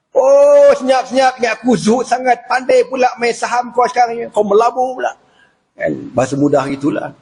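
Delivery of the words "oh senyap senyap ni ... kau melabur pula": high, mocking accusation; sarcastic Umar. Umar mocks Abu Darda for secretly investing, sarcastic. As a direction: tease him for secret wealth. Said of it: "Umar lagi".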